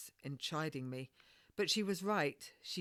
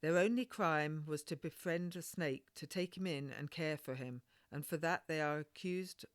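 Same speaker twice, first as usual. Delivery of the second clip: ordinary delivery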